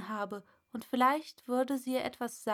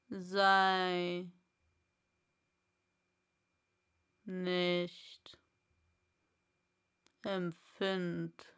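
slow, low caterpillar; drawn-out caterpillar advice; Raupe; 3 semitones lower, much slower than usual. Acted. Raupe voiced. (langsame, tiefe Raupe)

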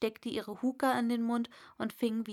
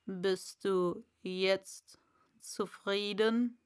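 slow, low caterpillar; lightly voiced caterpillar; 3 semitones lower, slower than usual. A narrator's reading. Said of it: "sagte die Raupe".

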